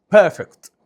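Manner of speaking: "بيرفكت" as "puh-fekt", no R in the middle; British dropped R. Shown as a demonstration. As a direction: say "بيرفكت" British style with the R silent. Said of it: "ممكن تسمع بريتش يقول".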